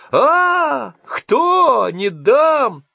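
furious shriek at the men sawing; sudden shriek. Acted. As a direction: scream like a woman defending her trees. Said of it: "она вдруг закричала".

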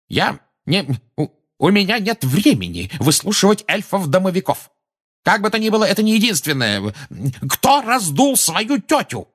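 stammering start into high, loud yelling; outraged official losing control; furious wail. A narrator's reading. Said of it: "завопил Фадж".

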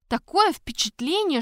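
ordinary delivery, nothing marked

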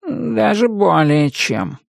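slow, high cat voice. A narrator's reading. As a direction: answer like a worried cat. Cat worried answer.

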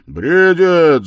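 very loud, high cry; boisterous drunken yell; tipsy shout. A narrator's reading. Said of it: "закричал хмельной Разумихин".

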